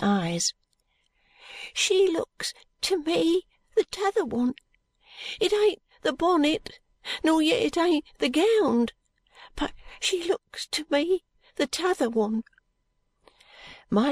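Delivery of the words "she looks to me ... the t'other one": put-on high, halting boy's voice; doubtful sick boy. A narrator's reading. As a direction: puzzle it out slowly in a high boy's voice. Doubtful boy studies the lady.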